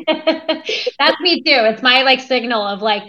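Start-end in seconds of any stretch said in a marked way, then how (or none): 0.0-1.6 laughing. Real, not acted. Laughs while agreeing.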